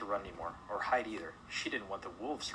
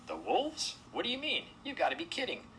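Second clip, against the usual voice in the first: high-pitched disbelieving questions; incredulous; 12 semitones higher than usual. Acted. Animals hearing Mona's wolf proposal, high incredulity. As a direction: react in disbelief, voice jumping up.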